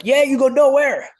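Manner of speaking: high, loud imitation of an insistent coach; retold insistence. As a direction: belt out the coach's order.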